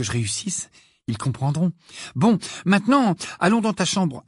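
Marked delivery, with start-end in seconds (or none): 2.2-4.2 bright-pitched, lively prompting; brisk. A narrator's reading. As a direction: say it briskly, pitched up, to get them moving. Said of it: "ajouta-t-elle d'un ton vif". (d'un ton vif, plus aigu)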